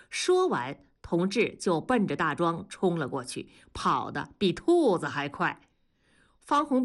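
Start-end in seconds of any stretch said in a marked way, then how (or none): none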